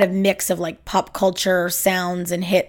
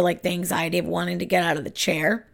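normal talking voice

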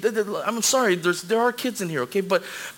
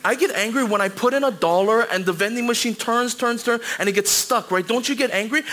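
ordinary talk

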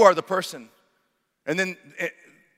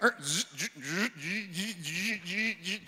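softer buzzing stammer; flustered sputter; 10 dB quieter, slower than usual. Retold or imitated. Salesman caught out, sputtering buzz noises. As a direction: sputter with no words. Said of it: "the guy was like".

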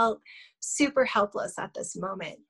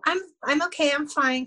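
higher-pitched, insisting all is fine; brushing off concern; 6 semitones higher, 3 dB louder than usual. Retold or imitated. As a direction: wave away help in a raised pitch. Brushing off offered help.

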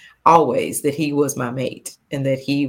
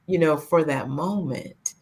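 ordinary talk

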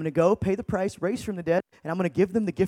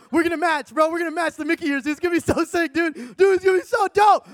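hyped-up friend; 7 semitones higher than usual. Acted. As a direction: gush like a kid just back from the park. Hyped friend planning the trip.

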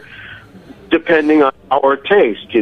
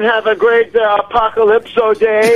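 high playful farewell; 8 semitones higher than usual. Real, not acted. Playful sign-off.

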